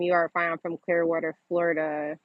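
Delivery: usual speaking voice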